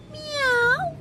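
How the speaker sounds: very high kitty meow; imitated high-pitched meowing; cat meow. A narrator's reading. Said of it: "said Cat".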